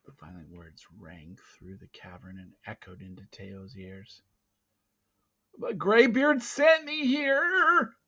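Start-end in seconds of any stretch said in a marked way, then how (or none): 5.6-8.0 put-on high, slow boy's voice; a frightened boy insisting, slow and high; boy's plea. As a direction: plead like a scared young boy. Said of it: "Teo said".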